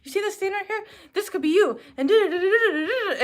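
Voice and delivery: mock the waitress lecturing on and on; pushy lecturing waitress. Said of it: "She's like".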